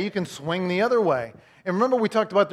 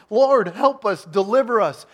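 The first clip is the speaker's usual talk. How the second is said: higher, louder plea; crying out; 4 semitones higher, 6 dB louder, slower than usual. Retold or imitated. Enslaved Israelites pleading to the Lord, raised voice. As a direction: cry out for rescue. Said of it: "they're crying out to the Lord".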